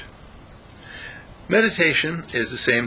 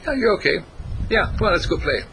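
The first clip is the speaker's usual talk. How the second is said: friendly dog; 6 semitones higher, faster than usual. Pretend. Voicing the friendly passive dog.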